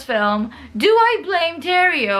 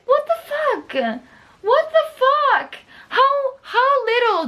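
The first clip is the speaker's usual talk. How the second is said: high-pitched outraged yelling; furious shout; 10 semitones higher than usual. Real, not acted. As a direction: scream it in disbelief at the leak. Furious rant about leaked ending.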